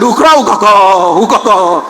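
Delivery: rhythmic copied stutter; class echoing the stammer. Retold or imitated. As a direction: chant the stammered word back exactly. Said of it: "The whole class shouted".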